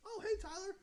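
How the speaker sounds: high-pitched grandma greeting; imitated grandma. Grandma answers her grandson's call, imitated in a high voice. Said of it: "she goes".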